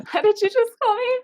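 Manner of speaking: high, loud, laughing disbelief; surprised. Real, not acted. Asking the friend about a surprising nickname, high, loud disbelief. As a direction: caught off guard by a nickname.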